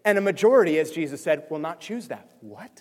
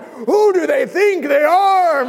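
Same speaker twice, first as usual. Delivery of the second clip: slow, drawn-out outraged shout, higher and louder; retold yelling; 11 semitones higher, 9 dB louder, much slower than usual. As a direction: bellow it like a heated debate. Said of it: "I'm like".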